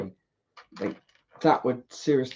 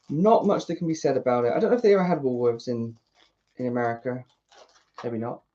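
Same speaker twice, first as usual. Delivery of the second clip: normal talking voice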